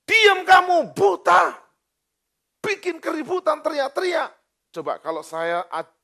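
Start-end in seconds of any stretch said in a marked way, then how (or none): 0.0-4.4 high, loud scolding shout; angry rebuke. Acted. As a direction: shut the blind man up harshly. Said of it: "banyak orang menegurnya supaya ia diam". (membentak marah dengan suara tinggi)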